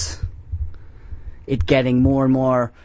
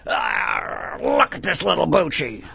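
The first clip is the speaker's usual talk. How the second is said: mock bro; 2 semitones higher, slower than usual. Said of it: "put me in a headlock and say".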